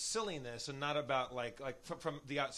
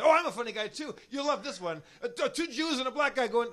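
coarse heckler; 10 semitones higher, 6 dB louder than usual. Retold or imitated. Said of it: "coarse assholes at a restaurant".

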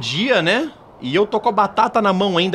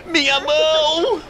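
criança ferida gritando; 10 semitones higher than usual. Acted. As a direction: wail like a hurt little boy calling for help. Criança ferida grita por ajuda. (menino machucado gritando por socorro, voz aguda)

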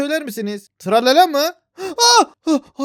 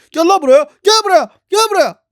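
loud, higher chasing yell; angry shout; 5 semitones higher, 8 dB louder than usual. Real, not acted. Gamer yelling while chasing the enemy, angry. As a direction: bellow after it.

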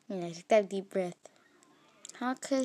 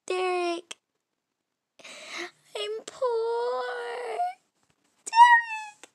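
high, loud exaggerated crying out; hammed-up distraught calling; overdone despairing cry; comic wail; 12 semitones higher, 6 dB louder, much slower than usual. Scene ending, crying out.

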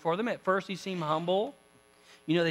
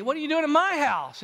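higher-pitched surprised question; mock astonishment; 7 semitones higher, 4 dB louder than usual. Jesse finding the prophet at home, playful surprise. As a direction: lift the pitch in playful disbelief.

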